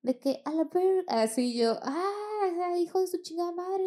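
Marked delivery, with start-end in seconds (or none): 0.5-1.0 cut-off high yell; high scream. Retold or imitated. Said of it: "Así que gritaba". 1.8-3.8 high cursing scream; screamed swearing. Retold or imitated. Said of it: "todo el swearing que pude".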